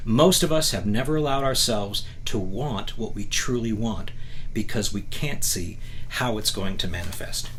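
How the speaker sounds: ordinary talk, nothing marked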